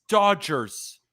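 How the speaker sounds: ordinary talk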